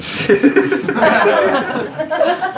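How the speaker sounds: laughing out, high pitched. Real, not acted. Laughing at old man rain.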